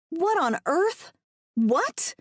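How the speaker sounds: high, disbelieving questions; yelping. A narrator's reading. Woman hearing nobody will come for them, yelping. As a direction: yelp in shocked disbelief. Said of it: "I yelp out".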